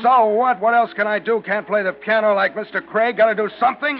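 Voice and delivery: put-on loud drunken shouting. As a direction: shout back at her. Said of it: "You have to shout?".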